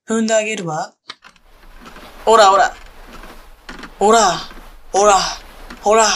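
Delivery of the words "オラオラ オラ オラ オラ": loud, punchy barked taunts; domineering mistress, loud rhythmic jeers; stomping taunts. Acted. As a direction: shout each one with a stomp.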